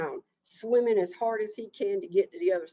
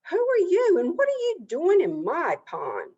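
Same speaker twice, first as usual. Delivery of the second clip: high, loud confrontation; loud, offended turtle demanding answers; indignant challenge; 11 semitones higher, 6 dB louder than usual. A narrator's reading. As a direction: challenge the intruder, starting very high.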